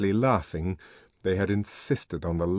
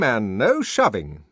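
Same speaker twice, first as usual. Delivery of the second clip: high, loud orders, slowing at the end; peremptory; 9 semitones higher, 6 dB louder than usual. A narrator's reading. Young man ordering the twins forward, peremptory. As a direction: command the crowd to line up. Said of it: "he said peremptorily".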